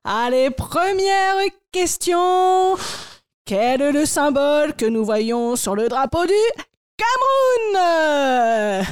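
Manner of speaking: milk the question like a cruise quiz host. Acted.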